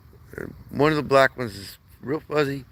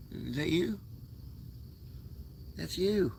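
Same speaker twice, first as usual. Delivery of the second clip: much higher, much softer cooing voice; 7 semitones higher, 13 dB quieter than usual. Real, not acted. Owner showing kitten its face, soft high baby talk. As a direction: coo gently at the kitten.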